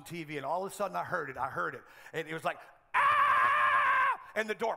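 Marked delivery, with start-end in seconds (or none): 2.9-4.2 piercing high shriek, much louder; a snake-startled shriek; imitated scream. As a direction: mimic a terrified wife's scream. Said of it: "I knew that scream".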